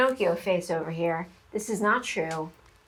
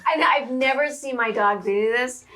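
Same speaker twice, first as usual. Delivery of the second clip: slow words through laughter; laughing; 4 semitones higher, slower than usual. Real, not acted. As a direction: laugh while telling it. Laughing retelling.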